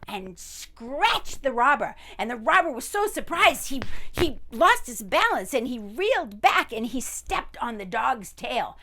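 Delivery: breathless excited narration. A narrator's reading. Chaotic fight scene builds up.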